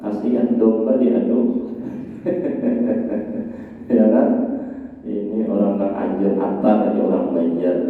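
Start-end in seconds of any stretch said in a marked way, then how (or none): 1.4-3.9 high, soft run of chuckles. Real, not acted. Preacher joking about poor sheep set fighting, chuckling. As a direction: chuckle at your own pun.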